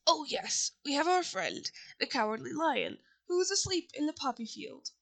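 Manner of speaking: ordinary delivery, nothing marked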